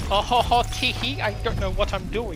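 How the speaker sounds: hammed-up ditzy girl gamer asking for help; mock girly voice. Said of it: "pretending to be a girl and be like".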